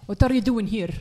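loud, put-on Arabic accent game villain. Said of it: "why do you have Arabic accent".

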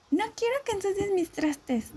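high-pitched mock protest; high, whiny retold scolding; complaining mother. Mother's objection voiced in a story.

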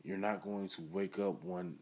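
ordinary talk

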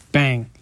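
loud spoken gunshot sound; mouth gunshot bang. Acted. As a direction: punch out a gun bang.

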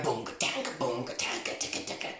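soft, low mouthed band noise; imitated background music. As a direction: mimic faint music leaking from headphones. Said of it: "you can hear, like".

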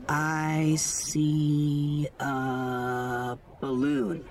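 very slow, each word stretched way out; exaggerated searching. A narrator's reading. Playing along with his focus game, exaggerated drawn-out observing. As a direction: draw it out as if peering hard.

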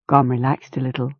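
usual speaking voice

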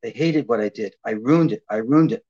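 low, sinking self-blame; inner despair. Retold or imitated. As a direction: mutter your own failure. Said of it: "I thought".

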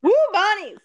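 loud, high-pitched whoop; excited fan's holler; team cheer. Real, not acted. Cheers for alma mater team.

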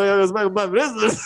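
ordinary talk, nothing marked

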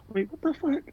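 high-pitched, hushed mock shock; retold disbelief. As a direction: replay being stunned, voice shooting up. Acted shock at mom's driving.

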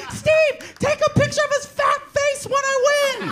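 very high, squealed order; hammed-up shrieky little winner; imitated gloating kid. Mark gloating to his brother.

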